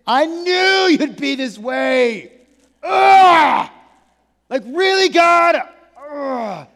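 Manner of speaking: loud, high, exasperated outburst with groans; tantrum. Acted. Jonah complaining to God about his mercy, throwing a tantrum. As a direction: throw a loud, drawn-out fit of frustration. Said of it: "goes into somewhat of a tantrum".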